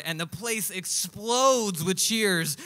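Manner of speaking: normal talking voice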